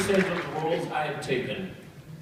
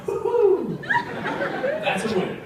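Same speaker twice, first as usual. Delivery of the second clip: mock cheer; much slower than usual. Mock celebration.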